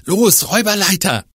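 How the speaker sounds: hoch und laut rufen; aufgeregter Ruf. Acted. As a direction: dringend nach oben antreiben. Fiete ruft auffordernd. (aufgeregter Ruf)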